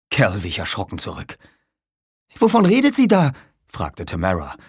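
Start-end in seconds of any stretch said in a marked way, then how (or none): 2.4-3.3 high, loud bewildered question; alarmed question. Acted. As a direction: ask in sudden alarm. (erschrockene Frage)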